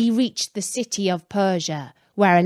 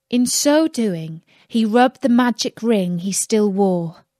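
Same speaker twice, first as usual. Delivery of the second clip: usual speaking voice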